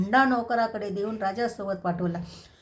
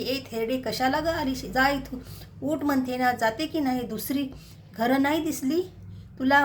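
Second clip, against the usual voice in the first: usual speaking voice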